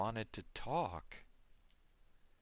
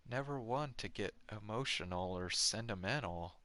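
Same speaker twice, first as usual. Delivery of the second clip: ordinary delivery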